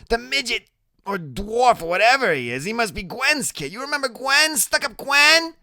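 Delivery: very high, excited gossip voice; Loretta, nosy. Acted. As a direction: gossip away in a high character voice. Loretta placing the boy.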